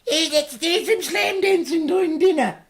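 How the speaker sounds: very high, loud mum impression; hammed-up mum voice; mum's voice. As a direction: cheer it like an excited mum. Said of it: "She'd be like".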